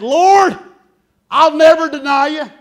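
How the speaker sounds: loud, high proud promise; Peter's bold vow. Retold or imitated. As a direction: boast it out like Peter swearing loyalty. Preacher acts Peter's bold vow.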